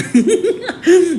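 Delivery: sudden high burst of laughter; amused high laugh. Real, not acted. Laughing at own travel story.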